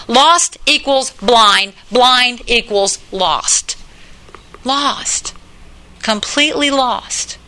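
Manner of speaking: very slow, each word set apart; slow emphatic. Real, not acted. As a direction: lay it down word by word. Preacher spelling out equation.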